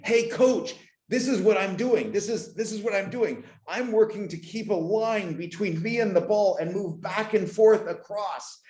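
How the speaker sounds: put-on high-pitched young player calling to the coach; excited retold call, pitched well up; eager kid calling out. Retelling himself calling to the coach.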